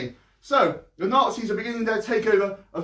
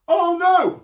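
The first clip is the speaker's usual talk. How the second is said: startled cry; 10 semitones higher, 6 dB louder than usual. Acted. Max finds family gone.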